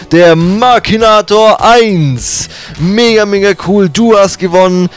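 drawn-out, loud, high winner reveal; hyped giveaway announcement. Real, not acted.

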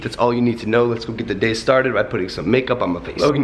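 ordinary talk, nothing marked

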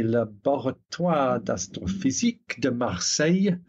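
put-on French accent on Laboratoire d'Astrophysique de Marseille. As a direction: read the French name with a French accent. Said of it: "decipher my horrible French accent".

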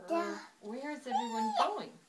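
curious bear. A narrator's reading. Bear asks the sleigh party.

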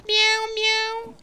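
high mock meowing; cat meow. Mocking enemy W G Meow.

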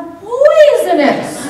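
mock surprise. Surprise reveal of poison.

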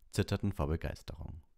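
ordinary delivery

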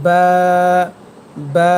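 বা held long, both lips pressed together to start it; drawn-out বা from the moist inner lips; letter articulation demo. Shown as a demonstration. Teacher showing how the letter ba is pronounced, long, lip-made বা. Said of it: "ভেজা অংশ একসাথে সংযুক্ত করে বা হরফটি".

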